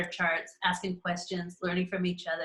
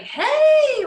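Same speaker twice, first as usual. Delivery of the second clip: much higher and louder, whooping; carefree call; 11 dB louder than usual. Pretend. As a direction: throw your hands up, let go. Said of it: "Or are we just like".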